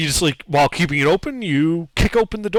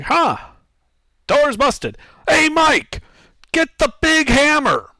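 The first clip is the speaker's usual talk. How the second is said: much higher, louder call to a friend; guard yells across the room for the big hammer, much higher and louder; 12 semitones higher, 5 dB louder, slower than usual. Acted. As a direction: shout for Mike to bring the hammer. Guard calls to Mike.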